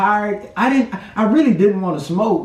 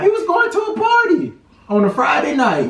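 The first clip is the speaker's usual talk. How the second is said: accusing shout; 6 semitones higher than usual. Real, not acted. Couple bickering.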